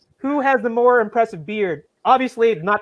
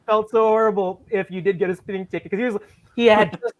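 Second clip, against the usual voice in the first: ordinary talk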